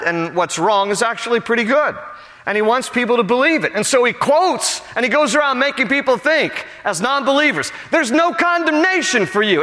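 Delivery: high, urgent preaching voice, pushing hard; full-force preaching. Acted. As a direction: build the sermon with rising fire.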